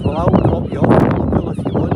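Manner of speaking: loud shout against the gale; shouting over wind. Real, not acted. Offering a lift to the camper, shouted over wind.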